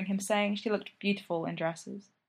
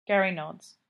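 usual speaking voice

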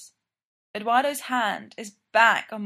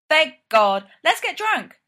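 loud, then a much higher whoop; triumphant cheer; 7 semitones higher, 5 dB louder, slower than usual. A narrator's reading. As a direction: punch the air with the words. Dustin celebrates, pumps fist.

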